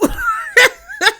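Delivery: squealing high-pitched laugh. Real, not acted. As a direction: burst into a shrill giggle. Laughs after mock rant.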